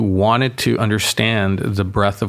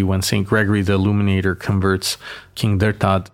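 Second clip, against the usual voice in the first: normal talking voice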